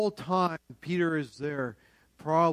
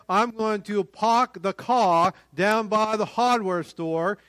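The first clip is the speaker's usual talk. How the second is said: Boston accent with dropped r's; 4 semitones higher, 4 dB louder than usual. Shown as a demonstration. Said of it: "That's a Boston accent".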